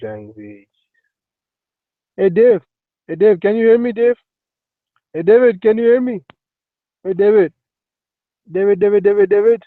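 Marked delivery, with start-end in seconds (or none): 2.2-9.6 raised, high-pitched calls for attention; loud, repeated hailing; calling out. Real, not acted. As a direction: call insistently to someone who isn't answering. Calling to David to hear.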